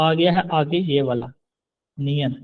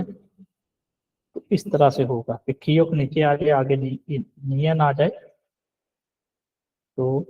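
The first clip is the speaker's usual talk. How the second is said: usual speaking voice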